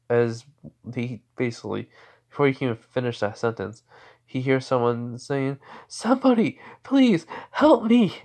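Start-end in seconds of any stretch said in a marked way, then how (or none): 5.9-8.2 calling for help. Acted. Unseen stranger beyond the portal, calling for help. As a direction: plead loudly, pitched right up. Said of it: "hearing someone calling out for help".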